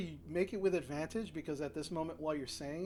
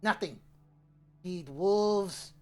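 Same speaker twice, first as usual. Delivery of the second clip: loud, broken-speech goblin chief, slow and sneering; goblin boss; 7 dB louder, slower than usual. Acted. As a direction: boast like a brutish goblin who hates his old boss.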